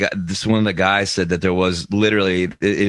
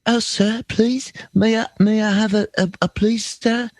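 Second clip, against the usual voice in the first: high, timid begging urchin voice; high mock Oliver Twist plea; pleading British urchin; 12 semitones higher than usual. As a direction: beg like a Dickens orphan. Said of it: "I've got three British voices".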